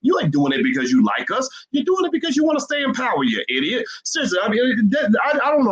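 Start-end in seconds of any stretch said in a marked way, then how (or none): 1.7-5.7 heated and high, getting worked up; really fired up at the politicians; exasperated high-pitched outburst; frustrated rant. Real, not acted. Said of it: "I'm getting triggered right now because sometimes I get frustrated".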